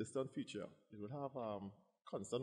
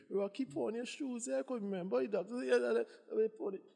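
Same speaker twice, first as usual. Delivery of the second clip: lightly voiced granny; 11 semitones higher, 4 dB louder than usual. Granny voice retold.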